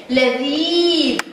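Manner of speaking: لذيذ with the ee stretched out; slow, elongated middle vowel in لذيذ; long vowel. Shown as a demonstration. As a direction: hold the ee in لذيذ slowly. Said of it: "مد بالياء والحرف الممدود الذال".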